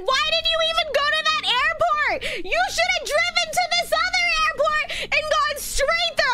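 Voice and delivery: high-pitched, nagging mock scolding; pissed-off mom. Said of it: "my mom was actually pissed at me".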